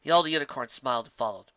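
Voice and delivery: usual speaking voice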